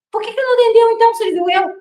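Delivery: quick, high-pitched mock indignation; retold indignant question. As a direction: fire it off in retold outrage. Retold confrontation at the door.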